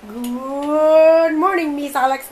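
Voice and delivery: very slow, high, stretched good morning; drawn-out sing-song greeting. Real, not acted. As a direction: stretch out each word playfully.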